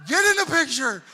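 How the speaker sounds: put-on loud, high yell at the kids; a loud, high yell at kids who won't stand still for a photo; retold yell. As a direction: bark it like a fed-up dad. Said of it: "He was just yelling at the kids".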